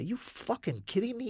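high, incredulous comeback; indignant retort, acted out in the retelling; retold anger. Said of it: "I'm getting mad now".

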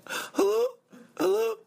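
very high falsetto mock girl; girl caller. Girl calling in to the show, high mock falsetto. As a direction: pitch it way up, girlish and questioning. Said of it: "the girl calls in, she goes".

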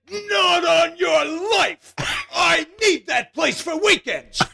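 flat refusal, hammed up; Mel's wife Annie. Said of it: "She said".

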